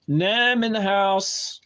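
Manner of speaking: slow, stretched, high and loud welcome; hyped shout-out. Real, not acted. Streamer hyping up a viewer joining the chat, loud and drawn out. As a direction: call it out like an MC.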